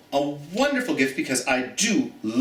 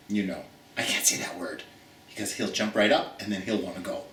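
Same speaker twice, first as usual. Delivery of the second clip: hushed; 6 semitones lower, 5 dB quieter, faster than usual. Real, not acted. Can't say walk near dog.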